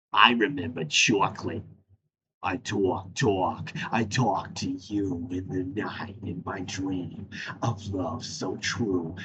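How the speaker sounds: low, slow, soft crooning mock lover; sexy man voice. Answering about chocolates as the sexy man, low soft croon. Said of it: "SpongeBob episode".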